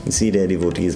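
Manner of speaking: ordinary talk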